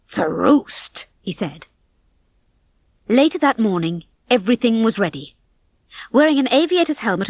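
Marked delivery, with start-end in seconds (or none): 0.1-1.1 mock Scottish, rolled out; Scottish accent. A narrator's reading. Rocky answers Ginger playfully, Scottish accent. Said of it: "spoke in a Scottish accent".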